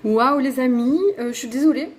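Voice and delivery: surprise réelle. Real, not acted. Réalise que la vidéo s'est coupée.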